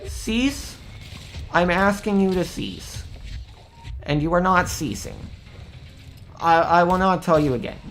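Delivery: mock-stern commands rising to anger; calm turning angry. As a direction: escalate from polite to furious. Demands escalate under fire.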